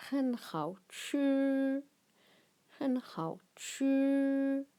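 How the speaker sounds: "Hen hao chu" said slowly and carefully for pupils to copy, tones held; slow teacher demo of "Hen hao chu"; slow Chinese model phrase. Shown as a demonstration. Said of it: "teacher models Chinese phrase".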